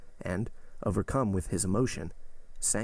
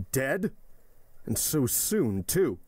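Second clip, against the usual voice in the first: shocked exclamation, rising high; amazed, sympathetic; 5 semitones higher, much slower than usual. A narrator's reading. Magistrate learns the patient has died, amazed and sympathetic. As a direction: take in the death with amazement. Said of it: "exclaimed with real amazement and sympathy".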